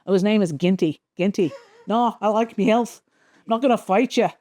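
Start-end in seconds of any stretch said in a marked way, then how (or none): 1.9-4.3 put-on Australian accent. Australian villain refusing to fight, put-on Australian accent. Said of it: "he's got his accent".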